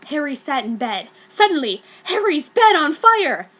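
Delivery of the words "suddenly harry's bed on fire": rising alarm, louder and higher; excited narration. A narrator's reading. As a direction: read it like a sudden shock. Dramatic narration of sudden fire.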